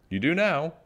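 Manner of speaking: high-pitched happy reply; cheerful little witch, voice lifted high. A narrator's reading. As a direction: say it happily, pitched well up. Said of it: "said Little Witch happily".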